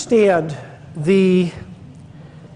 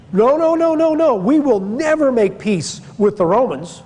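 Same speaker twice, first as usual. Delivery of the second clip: rebels flatly refusing peace, pitched up; defiant refusal; 6 semitones higher than usual. Acted. Zealots voiced refusing peace.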